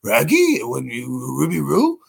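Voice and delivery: goofy cartoon dog, swooping pitch; talking dog putting r on every word; slurred pooch voice, rising at the ends; Scooby. Shown as a demonstration. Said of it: "Scooby are almost the same voice".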